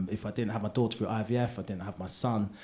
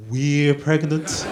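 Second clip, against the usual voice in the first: very slow, stretched we're pregnant; drawn-out correction; 3 semitones higher, 7 dB louder, much slower than usual. Retold or imitated. Husband correcting himself to wife, very slow. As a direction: savour the correction slowly.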